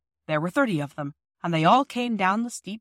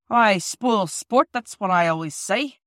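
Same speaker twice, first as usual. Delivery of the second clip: slow stern foreman voice; 6 dB louder, slower than usual. Acted.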